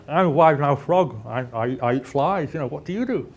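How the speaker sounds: loud, lively cartoon frog voice; wide-mouthed frog. Frog introducing himself to a snake, loud wide-mouthed voice. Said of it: "this wide-mouthed frog's walking through".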